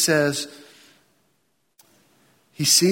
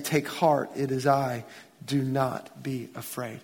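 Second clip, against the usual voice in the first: gentle reassurance; 5 semitones lower, 8 dB quieter than usual. Acted. Jesus reassuring frightened disciples.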